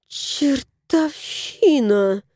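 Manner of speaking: very slow, muttered through teeth; woman cursing the haunted fridge; hissing through teeth. A narrator's reading. Женщина цедит сквозь зубы, очень медленно. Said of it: "процедила женщина".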